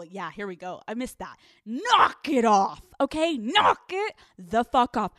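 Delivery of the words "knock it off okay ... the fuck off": loud, slow, punched "Knock it"; emphatic order. Pretend. Host telling dog owners to stop, slow emphatic order. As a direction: stretch and hammer "Knock it the fuck off".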